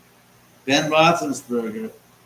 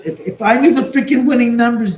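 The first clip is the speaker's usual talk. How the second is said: louder and higher, fed up; exasperated outburst; 9 semitones higher, 10 dB louder than usual. Real, not acted. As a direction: blurt it out, half laughing at the idea. Exasperated about lottery numbers.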